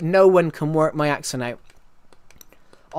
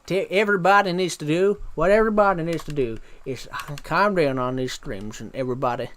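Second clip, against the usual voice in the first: put-on American accent. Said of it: "Do an American accent".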